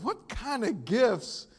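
can't believe what he's seeing; incredulous. Retold or imitated. Pastor reacting to the movie dad's gifts, loud and incredulous. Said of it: "And I said".

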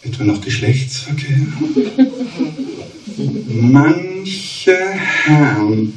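rhythmic cabaret verse reading; mock-solemn poetry reading; poem recital. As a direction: recite the verse with comic timing.

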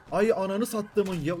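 loud, slow exasperated curse; loud frustrated oath; exasperated swearing. Real, not acted. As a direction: swear at the enemy through frustration. Cursing during tournament fight.

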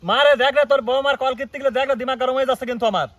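loud, high complaining shout; calling out angrily. Acted. Husband calling his mother about his wife, loud angry shout. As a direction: yell for mother to come and see.

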